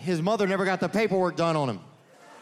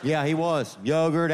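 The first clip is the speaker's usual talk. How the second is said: usual speaking voice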